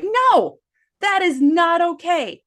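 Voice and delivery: loud, high, emphatic objection; re-enacted firm scolding. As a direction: put your foot down, retelling a mom's reprimand. Re-enacting her scolding of her son.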